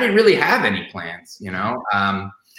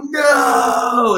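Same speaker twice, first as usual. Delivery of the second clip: a high mock shout of No; replaying the TV scene's big shouted No; imitated anguished cry; 12 semitones higher, 4 dB louder than usual. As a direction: ham up the character's despairing No. Retelling the actor's dramatic scene.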